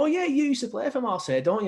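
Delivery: high-pitched gushing fan; high, eager retold question; retold star-struck greeting. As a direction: imitate your own drunken excitement. Drunk fan greets his idol.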